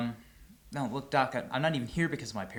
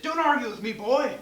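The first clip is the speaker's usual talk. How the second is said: loud, high gruff dad bark; mock yell of a stern dad; 13 semitones higher, 12 dB louder than usual. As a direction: bark it like an angry father. Dad voiced in retold story.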